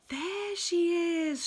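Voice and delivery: high, loud excited shout. Acted. Nicky shouting on spotting Mog, excited. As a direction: call out in relief. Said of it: "shouted Nicky".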